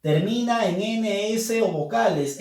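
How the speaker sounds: normal talking voice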